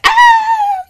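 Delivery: very high, very loud burst of joy; exuberant cry. Shown as a demonstration. Teacher demonstrating exuberance to the class with a very high, loud joyful cry. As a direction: let it explode out of you. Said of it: "that's more like exuberance".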